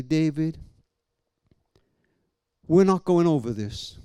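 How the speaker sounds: low, measured voice with long pauses; steady, deliberate divine reassurance; God's calm answer. Retold or imitated. God speaks to a wounded father, calm and firm.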